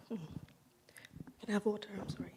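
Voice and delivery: a quick whispered plea for water. Real, not acted. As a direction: whisper the request off to the side. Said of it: "Sorry, dry throat".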